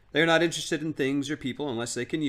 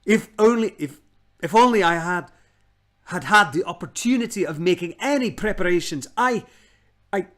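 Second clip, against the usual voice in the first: put-on flustered old man; 6 semitones higher, 8 dB louder than usual. Uncle Andrew flustered, fawning.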